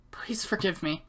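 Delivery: put-on hushed girl's plea; whispered plea. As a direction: whisper it, a girl begging forgiveness. Apology retake.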